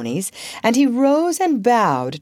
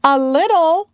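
high, loud, affronted echo; haughty pride; 10 semitones higher, 7 dB louder than usual. A narrator's reading. Wendy answers Peter's slight, haughtily. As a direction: repeat it with lofty indignation. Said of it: "she replied with haughtier pride".

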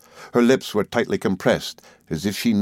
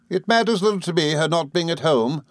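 higher, haughty dismissal; scornful woman's raised pitch; Rosa Dartle, haughty; 8 semitones higher than usual. A narrator's reading. Rosa brushing off an absence, haughty. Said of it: "said Rosa Dartle heartily".

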